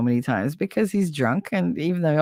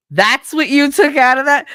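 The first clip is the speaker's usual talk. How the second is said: loud, raised, disbelieving; loud high disbelief; incredulous; 8 semitones higher, 8 dB louder than usual. Retold or imitated. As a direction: fling it back in disbelief. Incredulous retort.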